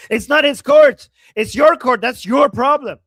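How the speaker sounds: loud, heated outburst; angry shout at the screen; real shout. Real, not acted. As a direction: yell it straight at him. Ranting at the host.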